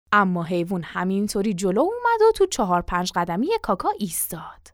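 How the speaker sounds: ordinary delivery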